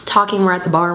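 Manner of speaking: ordinary delivery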